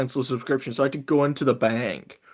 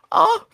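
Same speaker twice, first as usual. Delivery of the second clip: startled ah; 7 dB louder than usual. Retold or imitated. Scared kid at the bank.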